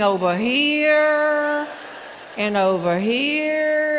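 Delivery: slow, elongated end words, mimicking a pendulum; drawn-out swinging. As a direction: stretch each "here" like a long swing. Acting out the bungee swing.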